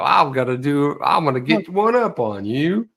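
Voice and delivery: mock cocky challenger, pitched a little up; hammed-up voice of a boastful banker; put-on knucklehead voice. Host showing the voice he launched into, mock boastful. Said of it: "That was just the voice I launched into".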